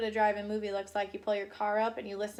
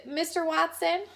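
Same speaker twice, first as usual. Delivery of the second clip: usual speaking voice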